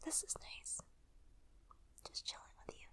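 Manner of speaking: real relaxed whisper; soft whisper. Real, not acted. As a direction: whisper it lazily to a friend. Relaxed ramble, lying down.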